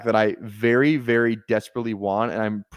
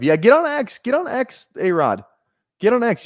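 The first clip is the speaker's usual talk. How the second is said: much higher, eager calling to chat; excited calling out; 13 semitones higher, 4 dB louder than usual. Real, not acted. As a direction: hype the viewer into joining. Urges a chatter to join X.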